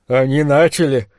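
ordinary delivery, nothing marked

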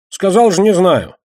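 high, loud, exasperated denial; irritated retort. Acted. Кегля answers a repeated question about Душечка, irritated. Кегля огрызается на повторный вопрос.

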